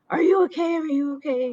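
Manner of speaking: mock urgent concern. Bystander checks on her after a fall, urgently concerned. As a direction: imitate a worried bystander calling out.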